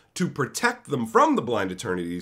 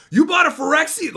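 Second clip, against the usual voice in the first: shrieked high and loud in alarm; startled yelp of alarm, way up high; mock freak-out shout; 14 semitones higher, 6 dB louder than usual. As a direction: cry it out, panicking at the sight. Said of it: "immediately freaks out".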